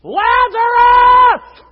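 huge, high, long-drawn shout; crying out. Acted. Jesus calls to Lazarus at the grave, crying out. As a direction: call the name out as if to raise the dead. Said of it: "walked out to that grave and cried".

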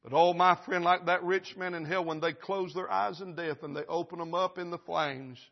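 normal talking voice

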